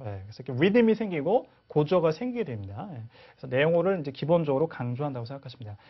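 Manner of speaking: ordinary delivery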